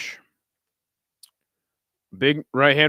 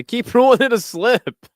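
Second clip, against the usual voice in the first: laughing; 12 semitones higher, 7 dB louder than usual. Real, not acted. Laughing at repeated slip rulings.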